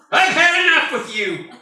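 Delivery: fed-up parent played for laughs; mock exasperated parent. Parent scolding child on bike.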